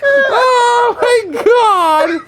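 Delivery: high, cracking laugh-cry; laughing till it hurts; helpless laughter. Real, not acted. Helpless laughter after joke.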